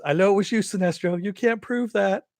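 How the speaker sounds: high, loud mock accusation; hammed-up accusing shout; Aquaman accusing. Aquaman yells at Sinestro at the party, high and loud. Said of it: "And he goes".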